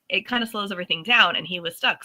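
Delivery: ordinary talk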